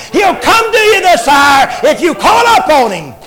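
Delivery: full-force Southern preacher cadence; preaching. Acted. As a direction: preach it hard to the congregation. Preaching climax to congregation.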